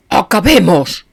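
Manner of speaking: loud, dropping like a stone; heavy flat command. A narrator's reading. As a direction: let it fall like a stone. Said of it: "su voz cayó como una piedra". (orden seca y pesada)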